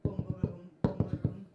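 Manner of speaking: thump out boom, boom, boom like a mic; imitated microphone thumps. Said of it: "a microphone going".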